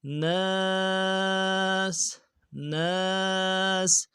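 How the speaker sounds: ناس with the alif drawn out; long alif. Shown as a demonstration. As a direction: stretch the a of ناس. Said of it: "الف مدہ ہے". (ناس میں الف کو ایک الف کی مقدار کھینچیں)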